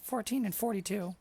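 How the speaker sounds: high, chatty inn host voice; friendly innkeeper. Acted. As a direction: welcome guests as the innkeeper.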